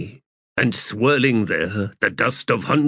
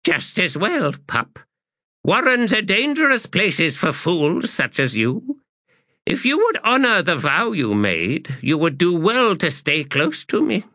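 raised, stern crone voice; stern old woman; 8 semitones higher than usual. Acted. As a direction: warn the fool sternly. Bonecaster warning.

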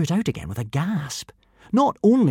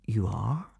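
polite but amazed; 6 dB quieter than usual. Acted. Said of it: "polite but amazed".

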